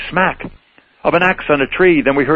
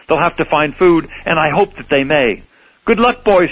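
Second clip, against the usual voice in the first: higher, peevish Lorax voice; snappish Lorax; 3 semitones higher than usual. Acted. Lorax scolding the Onceler for chopping trees, snappy. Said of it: "He snapped".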